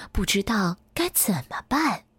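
plain reading voice

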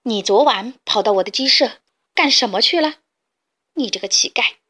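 loud, vicious snarl at the cat; fierce roar. Acted. Fat hen roars at the kitten, vicious and loud. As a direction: bark it at him viciously. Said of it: "恶狠狠地吼道".

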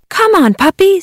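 high eager call; excited kids calling. Acted. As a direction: call the puppy over, high. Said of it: "said the kids".

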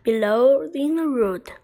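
ordinary delivery, nothing marked

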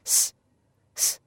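isolated voiceless "s", said twice with a pause; 清辅音s. Shown as a demonstration. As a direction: sound out a plain "s", tongue not between the teeth. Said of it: "非常简单的清辅音". (单独示范清辅音s，不咬舌头)

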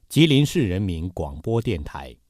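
normal talking voice

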